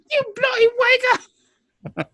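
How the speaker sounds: high mock British insult; British accent. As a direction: spit it like a cartoon Brit. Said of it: "He said it in an accent".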